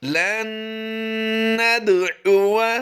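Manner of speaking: लन नदउवा chanted slowly and high with nasal ghunna on न; Quranic recitation. Shown as a demonstration. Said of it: "तो ये आवाज़ बन जाएगी".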